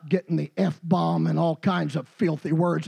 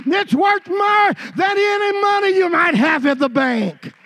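louder, higher preaching that builds to a shout; full-force preaching; 7 semitones higher, 5 dB louder than usual. Acted. Preacher warning his congregation, full-force preaching. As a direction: drive it home to the congregation.

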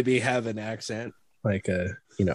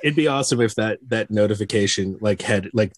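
usual speaking voice

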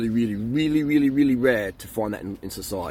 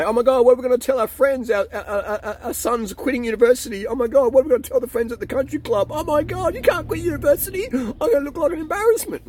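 shrill, much higher panicking parent voice; panicked parents; 13 semitones higher, 4 dB louder than usual. Retold or imitated. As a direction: squeal like parents terrified of the country club gossip. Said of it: "They're gonna be in an undercarb freaking out state".

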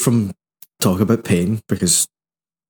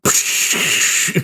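long airy hiss; imitated deflating hiss. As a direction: let out a long hiss like air escaping. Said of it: "unless they're going".

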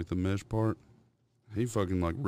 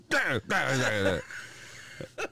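put-on high babbling baby voice; toddler babble; 4 semitones higher, much slower than usual. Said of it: "And he'd go".

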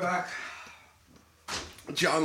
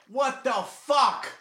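shocked disbelief; 7 semitones higher, much slower than usual. Real, not acted. Mouth burning from spicy food.

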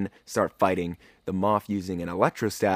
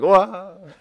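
mock whoa; 11 semitones higher, 8 dB louder than usual. Guards flung away by Grogu.

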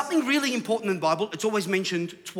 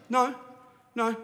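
ordinary delivery

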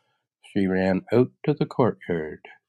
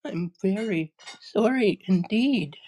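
put-on high girlish apology; frightened little girl; 13 semitones higher than usual. Dorothy apologises to the melting witch, frightened. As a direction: apologise in a high, scared child's voice. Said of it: "was truly frightened".

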